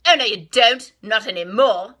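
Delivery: rat ordering. Acted. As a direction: boss them around like a bandit. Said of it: "declared the highway thief".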